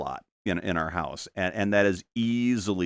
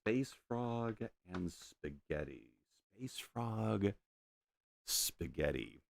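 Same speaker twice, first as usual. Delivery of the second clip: quiet, slow repeat to himself; half-voiced musing repeat; soft murmur; 12 dB quieter, slower than usual. Real, not acted. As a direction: murmur it under the breath. Repeats the phrase softly.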